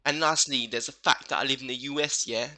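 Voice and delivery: hammed-up smug gamer reading his reply; mock tough-guy voice. Mocking commenter's reply, "yeah?" tags.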